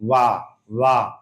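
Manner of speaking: teacher repeats the lip-teeth sound for students; teaching demo of वाह. Shown as a demonstration. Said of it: "निचला होंठ, ऊपरी दांत".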